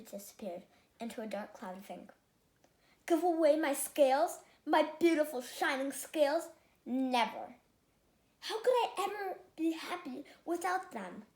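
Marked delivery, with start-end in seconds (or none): 3.1-11.2 louder, clutching his scales; a vain fish horrified at giving scales away; dismayed refusal. Acted. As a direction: refuse loudly, aghast.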